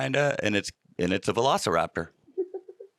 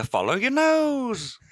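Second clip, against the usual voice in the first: cartoon mascot voice; 12 semitones higher, slower than usual. Toucan Sam catchphrase impression.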